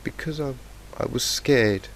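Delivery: crying. A narrator's reading. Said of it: "Fluttershy cried".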